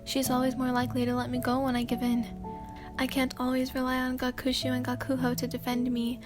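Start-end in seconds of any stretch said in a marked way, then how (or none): none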